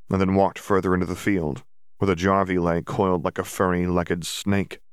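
usual speaking voice

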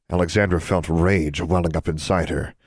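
usual speaking voice

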